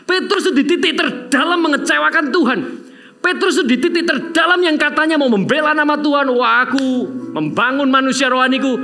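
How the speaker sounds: high, loud impassioned preaching; preaching at full force. Acted. Preacher crying out to the church about Peter. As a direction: pour it out over the congregation.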